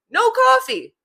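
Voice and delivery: high-pitched, emphatic tagline; emphatic repeat. Shown as a demonstration. Capping the saying for listeners, high emphatic repeat. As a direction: punch it out high as a punchline. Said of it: "I'm gonna say it just normally how I would say it".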